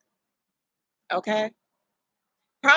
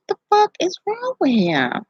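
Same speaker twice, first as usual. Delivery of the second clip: exasperated; 10 semitones higher than usual. Retold or imitated. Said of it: "It's like".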